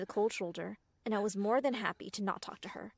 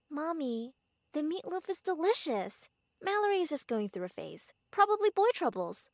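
higher, syrupy daughter voice; sickly sweet; 6 semitones higher, 3 dB louder than usual. A narrator's reading. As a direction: suck up to Mommy sweetly. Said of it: "talking in her sickly sweet voice".

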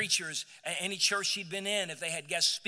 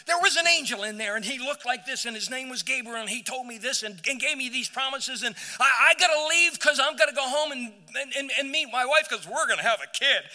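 loud, fast and high-pitched, bursting with news; excited rush; 6 semitones higher, 9 dB louder, faster than usual. Retold or imitated. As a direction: blurt it all out like a man who can't contain himself. Acting out excited Zechariah.

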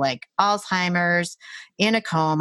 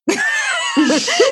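loud, very high laughing yelp; high-pitched laugh of mock alarm; startled laugh; 7 dB louder than usual. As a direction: burst into a high, flustered laugh. Acts out her startled reaction.

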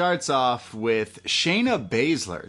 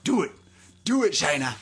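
higher-pitched mock Scottish yell, held back; Nikki Cross; 8 semitones higher, faster than usual. Host retelling a wrestler's taunt, mild mock yell. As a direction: lightly imitate a goading Scottish wrestler. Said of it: "can you do Nikki Cross".

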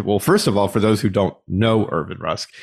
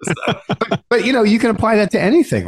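talking through a laugh, much higher; 12 semitones higher than usual. Real, not acted. Host moves on from a joke about the partner's eating, laughing. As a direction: let the laugh carry the words up high.

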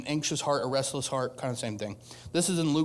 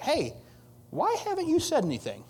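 high-pitched indignant challenge; frustrated Martha; 13 semitones higher than usual. Acted. Martha confronts Jesus over his silence, frustrated. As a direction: call Jesus out, pitch jumping right up. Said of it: "She's kinda a little frustrated with Jesus".

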